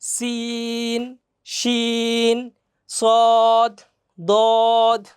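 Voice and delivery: each letter held very long; drawn-out letters. Shown as a demonstration. Teacher drags letters out for the class to repeat. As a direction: stretch the letters slowly. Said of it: "একটু টেনে টেনে".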